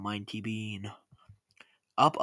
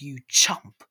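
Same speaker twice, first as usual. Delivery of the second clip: loud insult hurled at her son; angry yell; 9 dB louder than usual. A narrator's reading. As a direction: yell it hard and short. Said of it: "she yelled".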